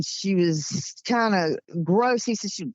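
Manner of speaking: usual speaking voice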